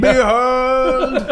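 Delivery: grand proclamation. Pretend. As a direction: proclaim it like a herald. Announcing becoming DM.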